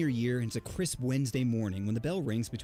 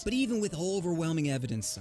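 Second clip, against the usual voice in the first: ordinary delivery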